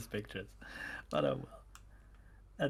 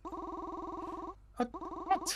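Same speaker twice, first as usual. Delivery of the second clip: cultist voice; 6 dB quieter than usual. Acted. Cultist startled by intruder.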